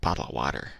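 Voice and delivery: "Bottle of water" with the T's swallowed; glottal-stop T. Shown as a demonstration. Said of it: "glottal stops".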